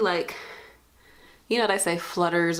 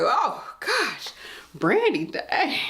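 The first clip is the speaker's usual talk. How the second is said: shocked coworker; 8 semitones higher, much slower than usual. Retold or imitated. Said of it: "it was just like".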